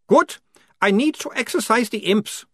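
very high, loud vampire voice. A narrator's reading. Otto asks the sergeant where they go, high vampire voice. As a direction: ask it in the high strange vampire voice.

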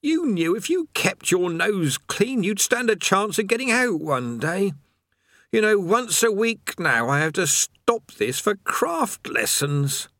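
weary prisoner griping; haggard chained man. A narrator's reading.

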